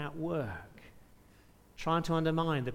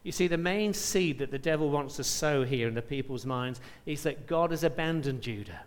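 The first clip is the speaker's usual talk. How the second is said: ordinary talk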